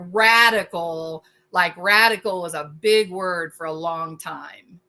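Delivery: ordinary delivery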